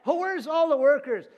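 high, loud mock lament about being left alone; self-pitying whine. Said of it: "Everybody's talked this way".

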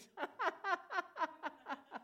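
high, rolling staged giggle; gleeful laugh. Shown as a demonstration. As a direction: let a long high laugh bubble up. Laughing over the scripture promise.

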